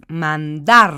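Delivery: Mandar with DAR said loud; loud teacher demo of mandar, stress on DAR. Shown as a demonstration. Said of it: "se encuentra en la última sílaba".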